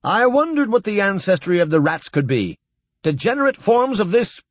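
carried away by a fascinating find; eager discovery. A narrator's reading. Horowitz to the others, suddenly thrilled and rushing on. Excited scholar cut off.

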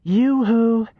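high sing-song call. Acted. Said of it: "a higher-pitched voice".

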